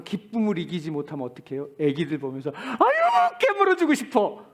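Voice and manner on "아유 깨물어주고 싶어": put-on high squealing coo over a baby; high, loud doting coo; doting baby talk. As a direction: gush like you want to squeeze a baby. Doting on babies, cooing.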